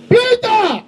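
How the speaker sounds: high, sharp call of a name. Acted. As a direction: call Peter to attention. Calling out Peter in story.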